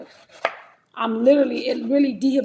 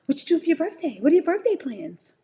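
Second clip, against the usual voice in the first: imitating mom; 5 semitones higher than usual. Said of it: "I didn't hear her telling me".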